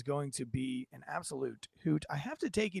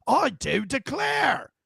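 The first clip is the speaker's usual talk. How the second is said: slow, drawn-out mock declaration, much louder and higher; mock grand proclamation; 8 semitones higher, 8 dB louder, much slower than usual. Mocking Russia's annexation claim with a slow hammed-up shout. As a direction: boom it out like a pompous decree. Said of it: "he says".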